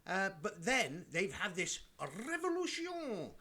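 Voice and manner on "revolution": high, drawn-out mock-French flourish; French accent. Talking about unrest in Paris, mock French accent. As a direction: roll it out in a hammed-up French accent.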